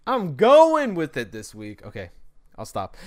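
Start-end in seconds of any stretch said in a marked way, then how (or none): 0.1-1.8 higher, louder, slow mock-grand declaration; hammed-up repeat. Real, not acted. Joking to listeners about a rambling episode, mock-grand and loud. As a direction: stretch it out like a silly proclamation. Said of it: "this is gonna be a horrible podcast".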